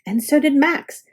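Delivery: plain reading voice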